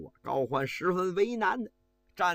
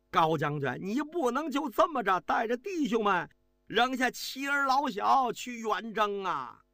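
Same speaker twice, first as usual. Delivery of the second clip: higher-pitched urgent plea, slowing at the end; loud pleading cry; 7 semitones higher than usual. A narrator's reading. Officer grabbing the general's robe, pleading loudly. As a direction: beg the general not to abandon the families. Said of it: "大声地喊道".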